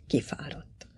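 very soft, low whisper; quiet repetition. A narrator's reading. Father repeating it to himself, whispering. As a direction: let it fade to a whisper. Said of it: "ismételte halkan".

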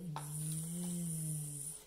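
long low bee buzz; baby bee. Acted. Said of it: "the baby bee says".

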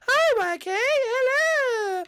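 very high, loud cartoon squeak; high-pitched silly hello; squeaky greeting.

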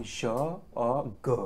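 drawn-out Je "zhuh", then hard G "guh"; two French sounds. Shown as a demonstration. Teacher showing French sounds, slow Je "zhuh" and G "guh". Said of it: "in French, we have".

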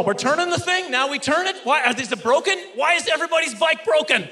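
high-pitched frantic questioning; comic panic climbing to the final question; hammed-up bewilderment; panicked confusion. Acts out panicked confusion in class.

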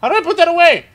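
panicked shout. Real, not acted. Gamer unable to put the gun away, panicked shout. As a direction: yell it in a sudden panic.

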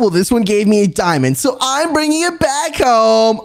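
ordinary talk, nothing marked

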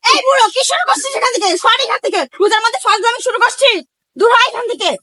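high, fast angry telling-off; angry scolding. Acted. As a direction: chase the beggar away angrily. Driving an old beggar away.

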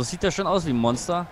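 usual speaking voice

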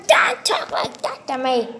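ordinary delivery

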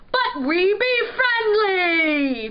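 slow, high, loud pirate boom. A narrator's reading. Pirate urging the dogfish to stay, booming. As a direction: boom it out heartily. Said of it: "Pirate boomed".

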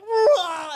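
high, loud wordless roar; mock yell. Passenger acting out losing control on a train, mock scream. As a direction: let out a sudden high "rah". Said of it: "I'm just like".

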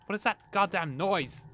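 loud, high, irritated demand. Real, not acted. Player bothered by a noise in the game, irritated. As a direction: raise the voice at a nagging noise.

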